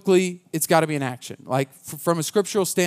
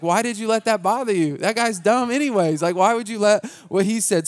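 higher, parodying his own brush-off; mock dismissive; 5 semitones higher than usual. Pastor parodying his old advice, mocking and higher. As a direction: make fun of how you used to wave it off.

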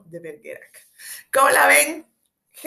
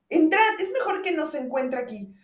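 urgent; 7 semitones higher, 7 dB louder than usual. Acted. Urging him inside.

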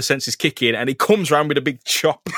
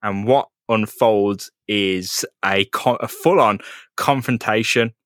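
usual speaking voice

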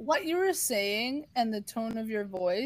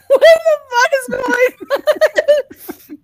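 loud, much higher, slow yell through laughter; laughing yell; 14 dB louder, much slower than usual. Retold or imitated. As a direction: shout it while cracking up in disbelief. Retelling shocked reaction to call.

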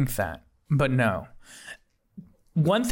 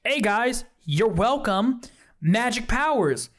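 higher, breezy announcer voice; mall owner; 6 semitones higher, slower than usual. Acted. Mall owner on the intercom, higher and breezy. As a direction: announce it like a smug host. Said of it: "the owner was like".

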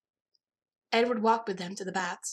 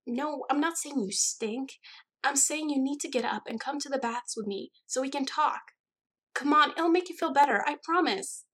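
high-pitched, urgent persuading; high, eager female-friend character voice; insistent friend; 6 semitones higher than usual. A narrator's reading. Alice pleads with reluctant friend.

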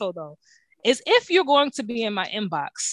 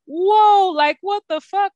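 much higher, louder whoa of shock; stunned exclaim; 9 semitones higher, 6 dB louder, slower than usual. Retold or imitated. Host retelling the flood of DMs, stunned and loud. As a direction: gasp at all the messages. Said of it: "And I'm like".

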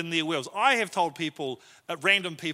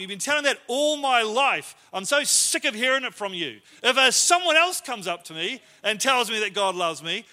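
raised, exasperated rant; fed-up outburst; 3 semitones higher than usual. Retold or imitated. Son turning on his mum over her preaching, exasperated. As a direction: lash out, fed up with hearing it.

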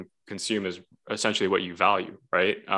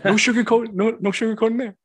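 Goggins impression; 14 semitones higher, 5 dB louder than usual. Keeps the Goggins voice.

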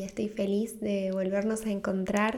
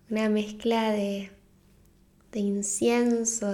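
guided meditation. Acted. Host guiding listeners through a winter solstice visualization, guided meditation. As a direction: ease them gently into the visualization, drawing out key words.

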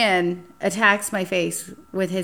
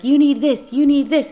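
loud, high, pushy date; mock insistent guy piling items in; pushy insistence; 7 semitones higher, 6 dB louder than usual. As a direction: push the makeup on her. Pushy date loading makeup.

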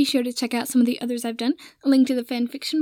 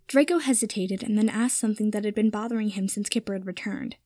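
plain reading voice

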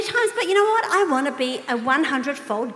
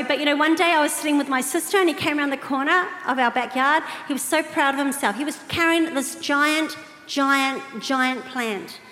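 normal talking voice

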